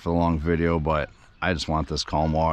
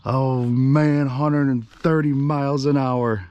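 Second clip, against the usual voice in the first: high, loud burst of real excitement; breathless and amazed, shouting over the engine; thrilled shout; 9 semitones higher, 5 dB louder, slower than usual. Real, not acted. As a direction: yell it out like you just broke a record. Hitting 130 mph on boat.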